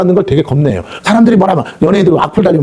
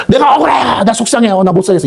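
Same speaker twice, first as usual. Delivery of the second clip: high, whiny protest to God; complaining cry; 7 semitones higher than usual. Retold or imitated. Believer whining to God about being wronged, high complaint. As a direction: wail out your grievance like a sulking child. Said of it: "이렇게 하면 안 돼".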